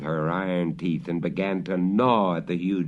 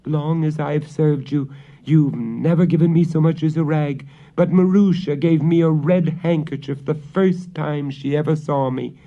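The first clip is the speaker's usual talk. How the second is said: put-on higher, louder servant girl; 7 semitones higher, 5 dB louder than usual. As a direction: stand up to the witch. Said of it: "the servant girl said to her".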